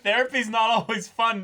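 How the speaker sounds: high, loud teasing objection; mock protest. Real, not acted. Podcast host teasing his cohost, loud mock protest. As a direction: playfully call out the cohost.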